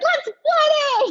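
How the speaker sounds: high, slow, hammed-up party call; mock eager cheer. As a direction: play the reckless party girl, high and drawn out. Mock cheer about flooding room.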